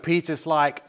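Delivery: ordinary delivery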